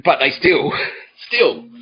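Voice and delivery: real laugh bursting through; laughing incredulity. Real, not acted. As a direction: crack up on the repeated word. Cracks up while telling the grievance.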